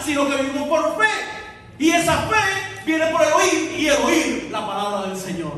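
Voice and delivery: loud, high, fervent proclamation; full-force preaching. Acted. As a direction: preach it out, building the point. (predicación a todo pulmón)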